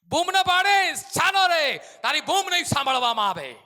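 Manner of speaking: loud, high rebuke; scolding. Acted. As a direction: crowd rebuking the beggar. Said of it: "ધમકાવે છે".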